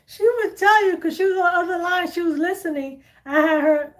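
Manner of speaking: high, laugh-broken words; laughing hard. Real, not acted. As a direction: talk through real laughter. Laughing hard while retelling.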